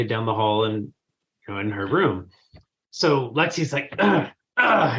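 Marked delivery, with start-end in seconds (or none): 4.0-5.0 two exasperated grunts, pitched up; imitated grunting. Mom imitates daughter throwing clothes around, grunting. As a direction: grunt like a fed-up child. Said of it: "and grunting and just throwing clothes".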